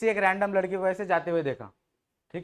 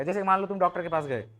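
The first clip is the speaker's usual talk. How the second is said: usual speaking voice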